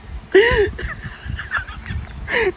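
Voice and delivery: a high, airy burst of laughter; high breathy laugh. Real, not acted. Laughing hard at their own teacher quiz joke, a high airy laugh. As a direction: crack up at the quiz answer.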